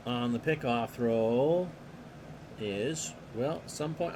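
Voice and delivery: steady announcer call of the action; play-by-play. Acted. Announcer calling the pitch to the listeners, play-by-play. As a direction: call the game like a radio announcer.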